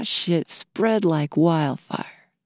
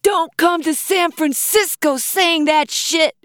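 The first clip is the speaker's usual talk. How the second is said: high, angry heckler's yell; 9 semitones higher, 4 dB louder than usual. A narrator's reading. Same woman walked up to the stage, angry yelling. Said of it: "yelled again".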